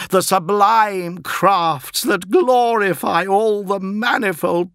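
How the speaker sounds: high, slow, loud declamation; grandiose heroic actor. Acted. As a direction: declaim it like a Shakespearean hero.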